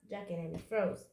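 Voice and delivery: ordinary delivery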